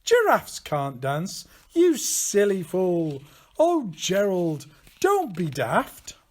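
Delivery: loud jeering taunt; mocking animals. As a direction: sneer at him from the crowd. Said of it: "The animals all laughed".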